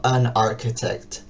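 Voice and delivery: "an architect" said slowly, "an" linked into "architect"; slow article demo. Shown as a demonstration. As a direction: glide "an" straight into "architect". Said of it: "suena más lindo si digo". (pronunciación lenta de "an architect")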